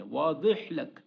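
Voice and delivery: واضح لک as "waadeh lak", ض close to a d; dad like dal. Shown as a demonstration. Teacher showing the Arabic sound, واضح لک with ض said like a heavy d. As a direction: say the ض of واضح لک with a touch of d. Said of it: "ضاد کو جو ہے نا تھوڑا سا دال کی آواز نکالنی ہے".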